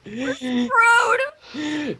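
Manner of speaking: mock despair.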